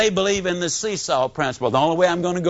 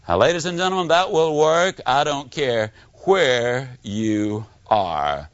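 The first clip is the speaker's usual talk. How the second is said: ordinary talk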